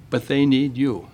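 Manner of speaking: usual speaking voice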